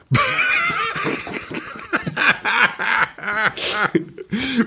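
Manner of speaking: high helpless giggling; long high laugh at the nickname. Real, not acted. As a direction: crack up at the joke. Laughing at a silly name.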